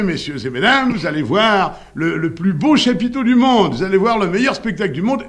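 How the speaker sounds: high, loud barker's pitch to the crowd; re-enacted big-top announcement, voice climbing high; stentorian circus barker. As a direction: call out like a fairground barker. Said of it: "avec ma voix de stentor".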